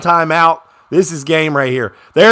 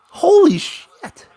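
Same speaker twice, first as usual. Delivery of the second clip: slow, higher, drawn-out gasp of a curse; stunned disbelief; 12 semitones higher, much slower than usual. Real, not acted. Fan watching the other team keep jumping offside, stunned. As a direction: let it fall out of you in shock. Disbelief at repeated false starts.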